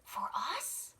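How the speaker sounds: high surprised cub question; amazed little cub; Sam's voice. Acted. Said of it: "whispered Sam".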